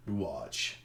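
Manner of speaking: low, quiet, knowing; confident boss. Retold or imitated. Boss reassuring his staff, low quiet voice. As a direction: say it low and sure. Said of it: "And he's like".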